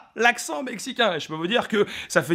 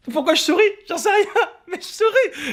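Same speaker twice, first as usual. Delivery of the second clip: giddy smiling; 10 semitones higher than usual. Retold or imitated. Imagining a smiling passerby.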